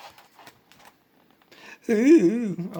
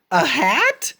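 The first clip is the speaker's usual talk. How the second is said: ordinary delivery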